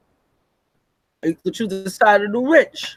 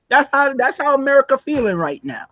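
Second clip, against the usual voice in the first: ordinary talk, nothing marked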